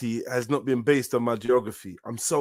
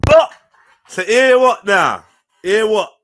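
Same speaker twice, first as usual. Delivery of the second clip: louder and higher, slow, stunned What now; loud disbelief; 6 semitones higher, 11 dB louder, slower than usual. Real, not acted. [name] reacting to the claim about the West, loudly incredulous. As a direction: demand he say it again, incredulous.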